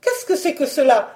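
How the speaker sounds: high, baffled baroness; startled, high-pitched query; alarmed question. Acted. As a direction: demand an explanation in a high voice. Startled wife questions the disease.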